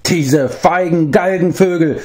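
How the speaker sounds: high-pitched, outraged bard cry; indignant cry. A narrator's reading. Bard rails at the enemy henchmen, high-pitched indignant cry. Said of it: "schrie der Barde".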